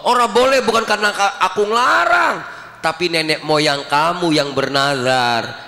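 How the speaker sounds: usual speaking voice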